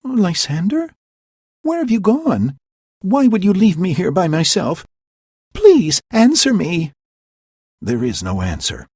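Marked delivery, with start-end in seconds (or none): 0.0-6.9 high, loud anxious calls; searching the dark for him; calling out anxiously. Acted. Hermia calling for missing Lysander, anxious.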